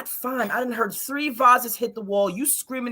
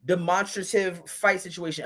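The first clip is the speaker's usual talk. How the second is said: usual speaking voice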